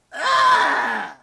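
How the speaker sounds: loud, high monster scream; long, high-pitched cry of rage; enraged shriek. A narrator's reading. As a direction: shriek in fury at the taunt. Goddess shrieks at the taunt.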